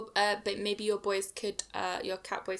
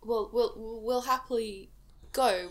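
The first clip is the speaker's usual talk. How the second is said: normal talking voice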